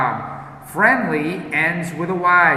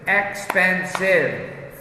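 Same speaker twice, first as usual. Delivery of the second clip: Expensive stretched slow, every syllable of Expensive clear; slow drawn-out model word; 3 semitones higher, much slower than usual. Shown as a demonstration. Teacher modelling Expensive slowly for students, stretched out. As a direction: say Expensive slowly so the class hears each syllable.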